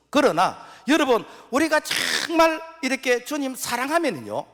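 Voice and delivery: high, loud preaching cry; preaching climax. Real, not acted. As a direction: lift the congregation with a ringing call. (높고 크게 외치는 설교)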